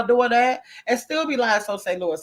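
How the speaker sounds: usual speaking voice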